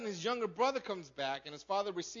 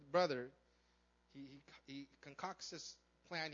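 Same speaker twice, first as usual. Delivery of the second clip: ordinary delivery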